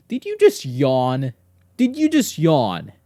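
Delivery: mock offence at a yawning dog; exaggerated shocked question; indignant disbelief. Real, not acted.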